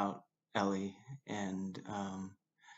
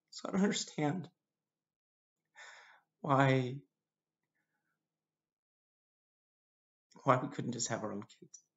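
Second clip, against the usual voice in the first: higher, upset voice with long breaks; holding back tears; 4 semitones higher than usual. Real, not acted. Husband asking why they couldn't have children, holding back tears. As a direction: struggle to get the question out, pausing. Grieving over infertility, long tearful pauses.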